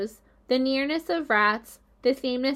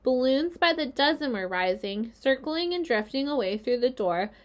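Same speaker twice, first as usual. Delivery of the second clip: ordinary delivery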